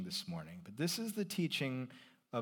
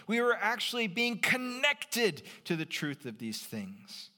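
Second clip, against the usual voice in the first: higher, louder fervent sermon; full-force preaching; 8 semitones higher, 3 dB louder than usual. Acted. Pastor urging the congregation about baptism, fervent preaching. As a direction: drive it home to the congregation.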